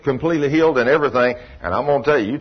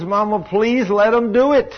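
retold scream; 10 semitones higher than usual. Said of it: "he said, screams".

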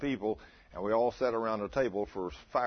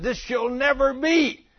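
much higher, louder, slow, voice unlike his own; protesting Peter; 12 semitones higher, 7 dB louder, slower than usual. Retold or imitated. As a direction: cry out in horrified refusal as Peter.

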